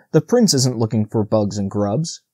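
usual speaking voice

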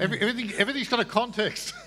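amused, chuckling rebuttal; laughing. Real, not acted. Laughing as he answers the questioner's challenge.

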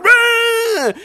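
high, loud cartoon roar; mock roar. Host mimicking the noisy movie crowd, loud roar. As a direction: yell it like a rowdy crowd. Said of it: "everyone going".

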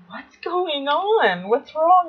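high, frightened questions; a kid sure something's terribly wrong; panicked. Retold or imitated. Retelling how she panicked to her aunt, high and scared.

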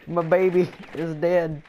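slow, hammed-up mourning; mock grief. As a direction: wail over a lost gadget. Mourning his gadget in game.

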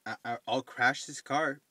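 jittery and wired on coffee; bursting out loud, over-caffeinated; too much caffeine. Real, not acted. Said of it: "yep, this is me on coffee".

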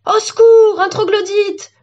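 loud, high-pitched cry for help; panic-stricken yell; panicked cry. A narrator's reading. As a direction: shriek in alarm at a cave dweller. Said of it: "paniquait Kenji".

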